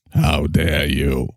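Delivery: low, slow disdainful 'How dare you?'; Greta's frozen-scowl voice, low and slow; Greta Thimble. Acted. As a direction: drop low and drag it out with disdain. Greta repeats her line.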